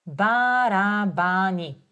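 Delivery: Барабани said slowly, ба-ра-ба-ни; slow demo. Shown as a demonstration. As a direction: draw Барабани out syllable by syllable. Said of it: "teacher models word slowly".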